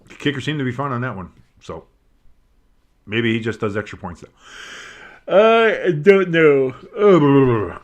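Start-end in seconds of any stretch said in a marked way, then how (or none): 5.3-7.8 words stretched through a big yawn. Real, not acted. Bored by a dull game, yawning out the words. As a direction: let the yawn swallow the words. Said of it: "sorry for the yawn".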